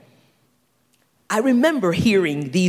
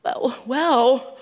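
imitating someone put on the spot, higher pitched; mock hesitation with a raised voice; hesitant stammer; 5 semitones higher, much slower than usual. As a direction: stall on each "well". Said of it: "some of us get, like, a little bit like".

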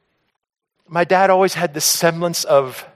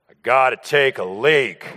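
dad's voice; 4 semitones lower than usual. Retold or imitated. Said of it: "And my dad said".

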